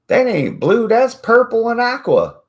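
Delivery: high, loud indignant squeal; shrill mock friends. Friends correcting him, high indignant squeal. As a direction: squeal it like a fussy friend insisting. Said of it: "And they're like".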